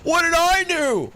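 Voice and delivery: very high, slow mocking whine; mock innocent whine. Mocking a driver who got honked at, high and slow. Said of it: "They're like".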